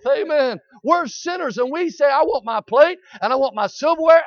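high, loud, hammering sermon; full-force preaching. Acted. Revival preacher exhorting the congregation, at full force. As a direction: preach it hard, driving each point home.

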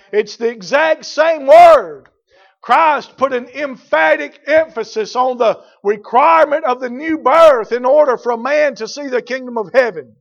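full-force preaching. Acted. Preacher stressing the requirement of new birth, full-force preaching.

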